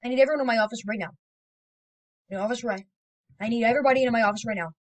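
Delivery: fast, loud bossy order; mock boss. Boss calling everyone into the office, bossy. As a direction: bark it like a hurried boss.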